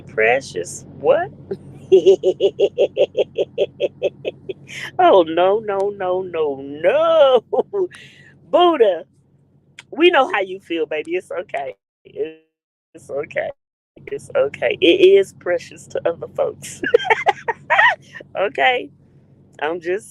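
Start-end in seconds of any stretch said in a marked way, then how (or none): 1.9-4.9 long run of rapid chuckles; prolonged giggling fit; laugh. Real, not acted. Laughing at viewer's comment. 16.9-18.3 high-pitched cackle; laugh. Real, not acted. Laughing at own remark.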